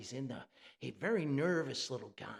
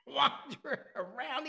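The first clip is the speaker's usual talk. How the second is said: usual speaking voice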